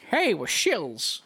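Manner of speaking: slow, hammy salesman; cheesy shill voice. Retold or imitated. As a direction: drawl it like a cheap ad. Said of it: "That sounds just like us".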